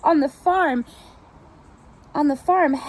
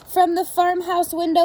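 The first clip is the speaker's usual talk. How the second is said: ordinary delivery, nothing marked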